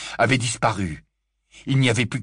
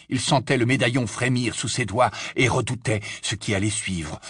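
ordinary delivery

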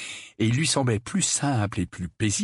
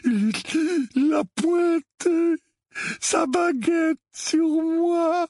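put-on grumbling boy; much slower than usual. A narrator's reading. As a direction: drag it out in a whimpering grumble. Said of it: "grommela Dudley". (garçon geignard qui grommelle)

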